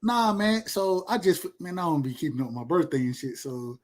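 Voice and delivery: ordinary talk, nothing marked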